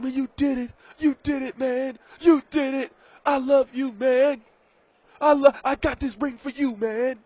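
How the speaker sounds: high, teary mock sob of a big brother; imitating a crying brother. Brother hugging Travis after the win, mock tearful and high. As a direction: ham up a choked-up brother's congratulations. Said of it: "you know Jason is crying".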